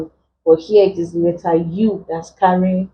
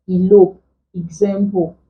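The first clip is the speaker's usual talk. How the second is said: normal talking voice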